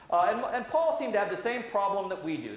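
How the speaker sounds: ordinary delivery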